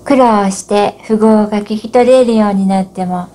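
ordinary talk, nothing marked

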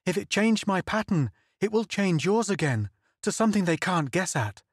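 high, urgent, fearful pleading; desperate pleading. Acted. Donna pleads with Kim on the circuit, high desperate urgency. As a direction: beg him to listen before it's too late. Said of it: "she cried desperately".

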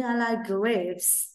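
I like grapes spoken very slowly, word by word; very slow model sentence. Shown as a demonstration. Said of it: "Ví dụ như".

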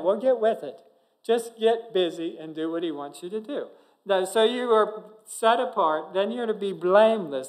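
normal talking voice

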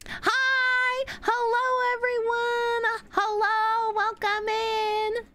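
high, bubbly streamer greeting; cute anime streamer. Acted. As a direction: sing out a cute high hello.

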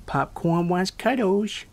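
put-on high, slow, babyish whine. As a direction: coo it like a toddler. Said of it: "saying in this baby voice".

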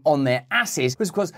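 ordinary talk, nothing marked

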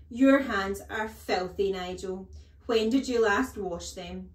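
loud, disgusted accusation; stern, slow disgust, then questioning; scolding headmistress. A narrator's reading. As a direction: tell off a pupil loudly. Headmistress scolds dirty hands.

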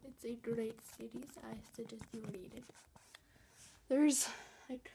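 normal talking voice

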